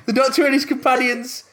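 high, grand synopsis voice; mock trailer read. Host reading the episode synopsis in a mock announcer voice. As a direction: read it like an old serial trailer.

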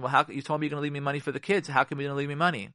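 fast, rushed complaint; quick nagging question. Retold or imitated. Wife confronting husband at the door, fast and nagging. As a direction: fire it at him the moment he walks in. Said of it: "you asked him".

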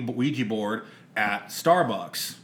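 normal talking voice

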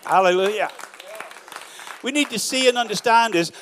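loud, driving preacher's call to the crowd; full-force preaching. Acted. Pastor rousing the church at full force.

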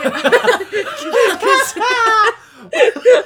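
loud, laughing through the words; giggling as she starts. Real, not acted. Laughing while starting her story.

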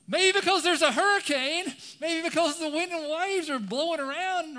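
high, very loud exasperated retort; incredulous yelling. Acted. Peter answers why he doubted in the hurricane, incredulous yell. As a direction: shout back as if it's blindingly obvious. Acts out incredulous reply to Jesus.

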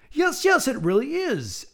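high, bright delighted cry; excited exclamation. Acted. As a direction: greet her with a joyful shout. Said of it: "exclaimed the voice from near the floor".

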